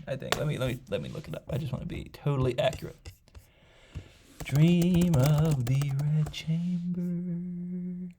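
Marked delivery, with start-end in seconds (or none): 4.4-8.1 very slow, singsong higher voice, holding the last word; absent-minded, elongated search murmur at full voice; drawn-out words. Real, not acted. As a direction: stretch each word out while looking it up. Drawls title while typing a search.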